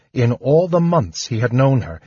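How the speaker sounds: ordinary delivery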